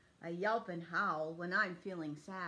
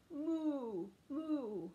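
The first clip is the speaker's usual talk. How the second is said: cow moo; 5 semitones higher than usual. Pretend. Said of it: "hear me moo".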